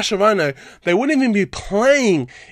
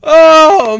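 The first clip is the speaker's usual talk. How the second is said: exasperated cry; 10 semitones higher, 12 dB louder than usual. Real, not acted. Exasperated outburst.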